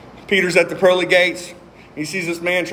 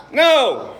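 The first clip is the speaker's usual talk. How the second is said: emphatic no; 6 semitones higher, 5 dB louder than usual. Real, not acted.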